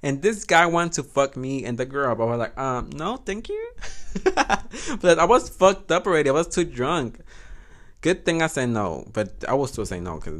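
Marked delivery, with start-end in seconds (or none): 2.6-5.0 mock polite. Polite refusal then laugh.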